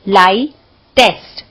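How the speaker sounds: Light, then a pause before test; split into syllables. Shown as a demonstration. Teacher to students counting syllables, light pause test. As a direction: tap out light and test one beat each. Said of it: "light test बोल के देखो".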